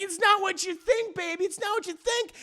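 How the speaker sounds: very high put-on wife voice; mock pleading. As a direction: plead as the wife caught cheating. Caught wife pleads with husband.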